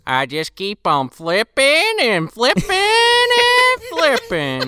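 high, slow drawn-out grandpa voice; lazy old man flipping burgers; mock grandpa drawl; old Gramps voice.

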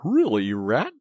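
pettish. A narrator's reading. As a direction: reprove a friend huffily. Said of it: "said the mole quite pettishly".

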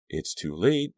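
plain reading voice

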